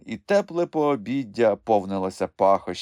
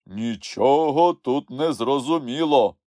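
slow, higher, drawn-out objection; slow, insolent contradiction; brazen interruption; 5 semitones higher, 4 dB louder than usual. A narrator's reading. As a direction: butt in boldly, stretching the words. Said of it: "зухвало втрутився Гемуль".

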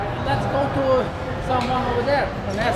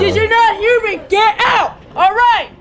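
loud, high furious yell; angry shouting; 10 semitones higher, 9 dB louder than usual. Real, not acted. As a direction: bark them out of the store. Staff throwing them out.

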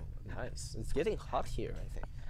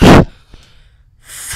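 breathless laugh; 28 dB louder than usual. Real, not acted. After the scare.

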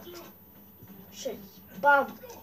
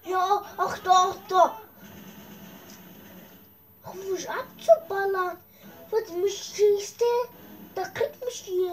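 excited; 6 semitones higher than usual. Real, not acted. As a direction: yell it out high in the heat of the fight. Excited during game fight. (aufgeregt und laut)

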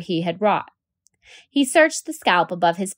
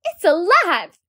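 high, loud startled scream; teacher shrieking at a creature in class; 12 semitones higher, 6 dB louder, slower than usual. Acted. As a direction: shriek it out in fright. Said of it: "she screamed".

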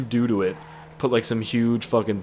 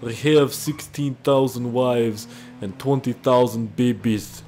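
hammed-up Russian accent; Vladimir Putin. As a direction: put on a heavy, comic Russian accent. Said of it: "trying to do a Russian accent".

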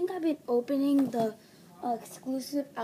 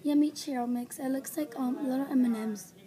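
normal talking voice

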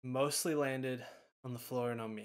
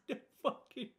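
laughing disbelief; 12 semitones higher, 7 dB louder than usual. Real, not acted.

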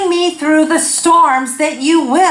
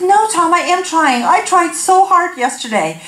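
higher, pleading self-defence; mock indignant protest; 6 semitones higher than usual. Wife defending her dieting to husband, mock indignant protest. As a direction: insist it with comic hurt.

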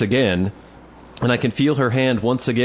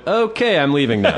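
high, hurried bail-out line; pitched-up eager escape; mock-nervous goodbye; nervous exit; 7 semitones higher, 3 dB louder than usual. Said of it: "And then I went".

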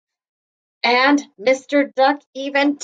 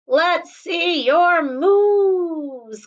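playful call; 5 semitones higher, much slower than usual. Acted. Pig calls to Cow.

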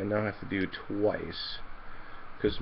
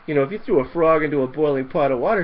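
normal talking voice

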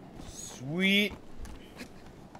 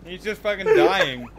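louder, chuckling through the words; laughing along; 5 semitones higher, 9 dB louder than usual. Real, not acted. Co-host reports partner's laughing fit while laughing himself. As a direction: talk while catching the laughter.

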